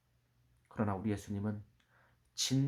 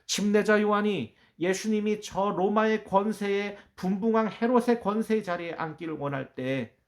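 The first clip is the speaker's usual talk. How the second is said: raised, louder pulpit proclamation; impassioned preaching; 9 semitones higher, 8 dB louder than usual. Acted. Preacher proclaiming Jesus' kingdom to the congregation, impassioned and loud.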